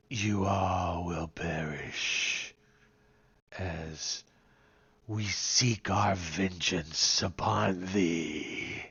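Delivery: slow, low undead warrior voice; booming doom from the grave; dark lord. Acted. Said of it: "and he says".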